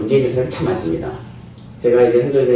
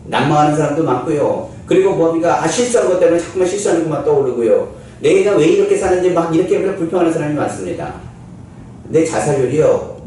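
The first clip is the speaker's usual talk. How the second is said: ordinary talk, nothing marked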